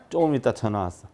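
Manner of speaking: normal talking voice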